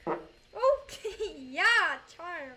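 laughing okay, yeah; amused laughing reply. Real, not acted. Laughing at "charm" remark.

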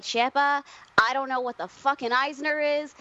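high, slow mock mom voice, proud to bursting; imitating mom. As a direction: play her proud mother, drawing out the end. Mom imagined reacting to award.

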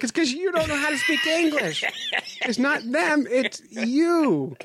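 very high-pitched retold protest; retold exasperation, high and insistent; exasperated retort.

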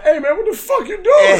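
high-pitched, indignant mock yell; retold angry outburst. Michael J. Fox catching Larry drawing, angry shout. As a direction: blurt it out in outraged disbelief.

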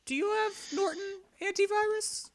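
very high, put-on voice; mock concerned questioner. Quizzing a billionaire about antivirus, high mock concern. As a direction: ask gingerly in a high little voice.

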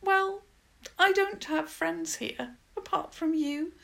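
put-on high-pitched, plaintive girl's voice; high pleading whimper, easing towards the end. A narrator's reading. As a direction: whimper out a helpless confession. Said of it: "She whimpered".

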